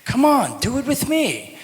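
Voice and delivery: mock YouTube accent coach; imitating the YouTube teacher. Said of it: "she's like".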